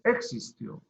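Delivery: "existió" said ÉX-istio, first syllable stressed; wrong stress on E. Shown as a demonstration. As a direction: punch the opening e of "existió". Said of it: "ni existió". ("existió" acentuado al inicio, éxistio)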